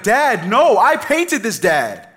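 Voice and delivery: put-on high, loud protesting kid; a daughter furious that her painting is taken; mad child. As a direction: insist like an angry little girl. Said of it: "she got real mad".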